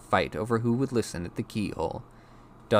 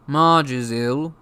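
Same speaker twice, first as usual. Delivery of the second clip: raised, loud, slow announcement; Uncle Vernon; 7 semitones higher, 9 dB louder, slower than usual. A narrator's reading. Uncle reading a postcard to his wife, loud announcing. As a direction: voice a loud uncle passing on news.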